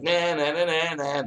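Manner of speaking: put-on high sing-song "nah nah" taunt, like a kid sticking his tongue out; mocking taunt. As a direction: tease them in a high childish chant. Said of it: "mocking you".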